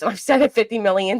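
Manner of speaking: ordinary talk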